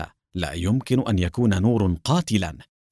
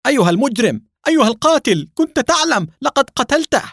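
much higher and louder, shouting accusations; furious shout; 4 dB louder than usual. Acted. Shamir leaps at Nour accusing him of murder, furious shout. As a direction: scream it at the killer, beside himself. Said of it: "وهو يصيح في غضب".

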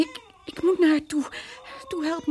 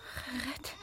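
faint disbelief; 16 dB quieter than usual. Acted. Vrouw nauwelijks bij bewustzijn.

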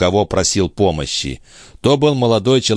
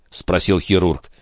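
plain reading voice

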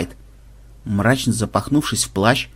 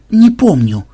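curt cut-off; 5 semitones higher, 6 dB louder, slower than usual. Acted. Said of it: "быстро отрезал Ксенобайт".

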